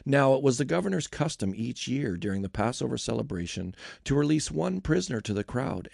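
usual speaking voice

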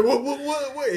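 put-on a kid flustered and about to cry, blurting what; panicked stammer. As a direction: friend panicking at the bleeding foot, high flustered repeats. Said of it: "He just sitting there".

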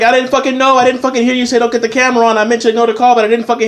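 high-pitched, rapid excuse-making; retold defensive protest. Acted quarrel, defending himself to friend.

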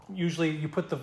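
usual speaking voice

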